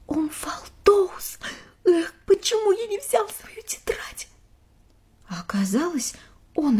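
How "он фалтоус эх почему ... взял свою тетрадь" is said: high excited whisper; breathless awed whisper. Acted. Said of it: "мы услышали шёпот Ника".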